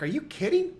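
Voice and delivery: high, disbelieving are you kidding; mock-shocked retort; incredulous. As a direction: scoff in disbelief. Retold incredulous reply.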